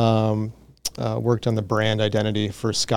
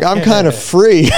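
laughing it out, slow and high; relieved giggle through the words; 7 semitones higher, 7 dB louder, slower than usual. Retold or imitated.

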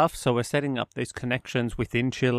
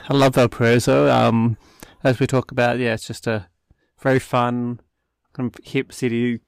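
excited gushing; 7 dB louder than usual. Real, not acted. Gushing about favorite city.

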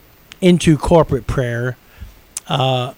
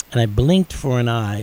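ordinary delivery

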